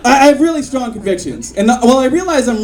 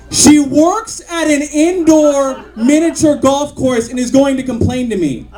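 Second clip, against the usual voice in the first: usual speaking voice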